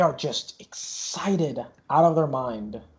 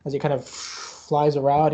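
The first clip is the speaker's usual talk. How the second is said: usual speaking voice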